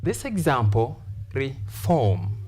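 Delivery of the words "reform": "reform" split, FORM held long and falling; "reform" as ri... FORM, slow and careful; long second syllable. Shown as a demonstration. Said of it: "long vowel sound o".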